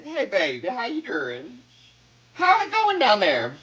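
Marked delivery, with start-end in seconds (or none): none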